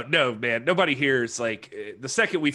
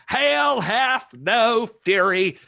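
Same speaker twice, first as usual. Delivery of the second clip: slow, loud, high preacher's boom on "Hell Hath No Fury"; Southern Baptist preacher; 9 semitones higher, 6 dB louder, much slower than usual. Shown as a demonstration. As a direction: stretch out "Hell Hath No Fury" like a pulpit call. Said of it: "I got real Southern Baptist right there".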